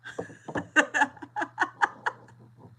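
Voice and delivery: long wheezy laugh with no voice; breathy silent laugh. Real, not acted. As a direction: laugh it out, almost soundless and high. Laughing at her own story.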